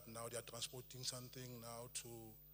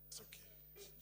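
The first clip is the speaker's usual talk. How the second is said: whispered aside; 11 dB quieter than usual. Real, not acted. Quiet aside off mic.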